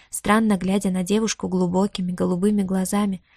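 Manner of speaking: plain reading voice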